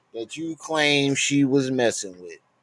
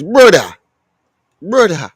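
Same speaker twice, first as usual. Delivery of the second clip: loud, higher grim mock solemnity; 5 semitones higher, 9 dB louder than usual. Responding to sad news in a loud, grim mock voice. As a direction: intone it with mock gravity. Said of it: "In my grim voice".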